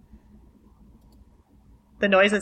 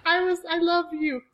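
laughing; 8 semitones higher than usual. Real, not acted. Laughing apology to Scott.

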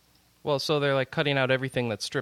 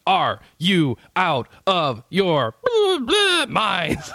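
outraged fan; 3 semitones higher, 4 dB louder, much slower than usual. Retold or imitated. Reading an outraged fan comment.

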